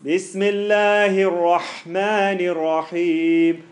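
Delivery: slow, loud "Bismillah" with the mouth held open. Shown as a demonstration. Teacher shows the default open mouth shape. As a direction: keep the mouth open and drawn out. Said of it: "My mouth is already set".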